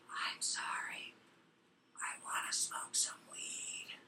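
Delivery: slow, drawn-out whisper; hushed mock-apologetic whisper; whispered into ear. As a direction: lean in close and whisper it slowly. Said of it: "leans into my ear and goes".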